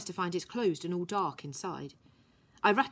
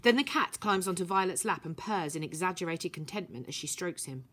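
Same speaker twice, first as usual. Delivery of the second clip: ordinary delivery, nothing marked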